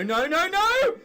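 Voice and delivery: ordinary delivery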